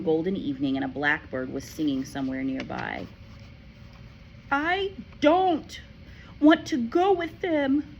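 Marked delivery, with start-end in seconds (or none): 4.5-8.0 put-on high, loud, slow child's shout. A narrator's reading. Matilda shouts at Miss Honey, refusing. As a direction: dig in and shout it. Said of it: "Matilda shouted".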